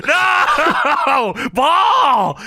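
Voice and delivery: hammed-up anguished shout; long, high mock cry of horror; Kane. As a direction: wail it loud, played for laughs. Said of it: "And Kane says".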